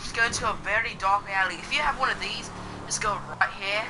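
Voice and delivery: playful put-on English accent. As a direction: chat along in a jokey English accent. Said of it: "I'm just gonna talk in this English voice".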